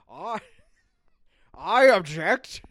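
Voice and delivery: loud, slow Scottish Connery brogue with a laugh; laughing Scottish accent, booming out the objection; Sean Connery accent. Retold or imitated. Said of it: "I can't do his accent".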